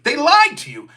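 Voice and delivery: normal talking voice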